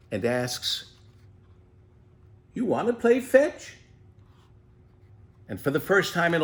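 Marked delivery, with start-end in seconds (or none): 2.5-3.8 put-on higher, bright young boy voice; young Ted. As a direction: welcome the tortoise home as a glad boy. Ted invites tortoise to play.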